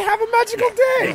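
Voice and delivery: high, sugary park greeting; Disney cast member voice. Retold or imitated. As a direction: chirp it like a Disney host. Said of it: "They say".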